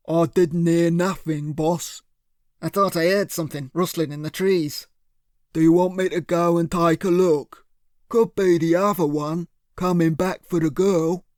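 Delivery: a hint of a dim heavy; henchman Cyril. A narrator's reading. Cyril answers his boss, a lightly voiced dim thug.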